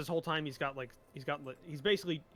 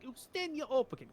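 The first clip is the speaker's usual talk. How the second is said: higher, said in the NPC's accent; 10 semitones higher, 3 dB louder than usual. Shown as a demonstration. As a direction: say the name Ustenya Opokin in the NPC's accent to get into it. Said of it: "I have a phrase that gets me into it".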